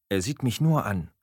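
ordinary delivery, nothing marked

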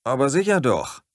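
eager grinning reply. A narrator's reading. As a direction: say it with a grin. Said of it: "grinste ich".

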